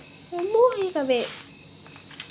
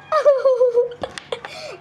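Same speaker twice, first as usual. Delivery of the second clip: panicked high laugh; 11 semitones higher than usual. Real, not acted.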